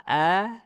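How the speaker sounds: wide-mouthed "a" set against i; fathah. Shown as a demonstration. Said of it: "Kalau dia fathah".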